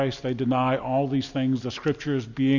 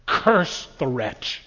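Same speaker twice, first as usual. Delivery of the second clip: scornful quote; much slower than usual. Retold or imitated. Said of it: "he wrote in his journal about Jesus".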